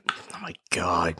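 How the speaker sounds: whisper. Real, not acted. Reacting to hot sauce squirt.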